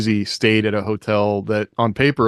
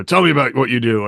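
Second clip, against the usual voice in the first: mock crotchety; 3 semitones higher than usual. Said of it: "super crotchety guy".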